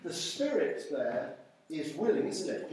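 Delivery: ordinary talk, nothing marked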